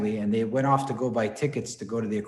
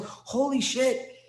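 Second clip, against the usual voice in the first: astonished; 11 semitones higher, much slower than usual. Retold or imitated. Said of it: "And he goes".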